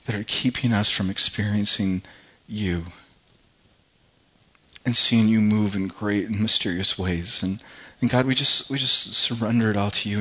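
hushed, earnest prayer voice; soft closing church prayer; softly spoken prayer. Acted. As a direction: pray it quietly to God, unhurried.

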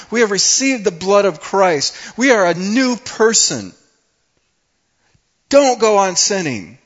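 high, loud preaching shout; on fire. Acted. Preacher urging the church to stop sinning, fiery shout. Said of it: "Paul is on fire here".

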